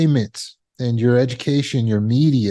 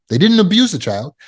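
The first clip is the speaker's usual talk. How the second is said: usual speaking voice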